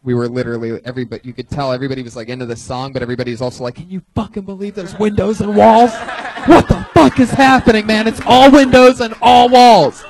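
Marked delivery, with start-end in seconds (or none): 3.8-10.0 loud, high, disbelieving shout; amazed yelling. Retold or imitated. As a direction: shout it like you can't believe it. Crowd amazed at the party.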